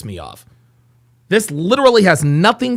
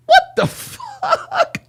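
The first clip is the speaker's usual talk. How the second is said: very high, very slow disbelieving squeal; drawn-out high-pitched outrage; shocked squeal; much slower than usual. Real, not acted. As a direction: stretch it out in shrill disbelief. Disbelief at the clip.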